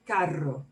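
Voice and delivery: "carro" with a strong rolled double R; trilled R. Shown as a demonstration. Teacher modeling the word for car, "carro" with a trilled R. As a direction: roll the R firmly in "carro". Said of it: "if you trill the R a little less".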